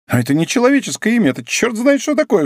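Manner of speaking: high, hurried outburst; exasperated cry. A narrator's reading. Commissioner baffled by the name Athos, exasperated cry. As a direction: exclaim as if losing your head. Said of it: "вскричал бедный комиссар, начинавший терять голову".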